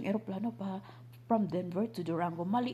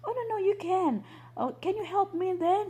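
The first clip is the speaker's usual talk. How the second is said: eager plea; 11 semitones higher than usual. Retold or imitated. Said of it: "sabi ko sa kanya".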